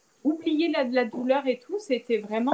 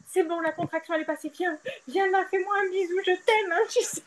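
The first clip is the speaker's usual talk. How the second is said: tender relief; 8 semitones higher than usual. Retold or imitated. After contraction, tender to husband.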